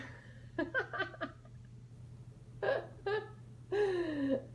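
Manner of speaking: giggling; laughing. Real, not acted. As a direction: laugh at the joke.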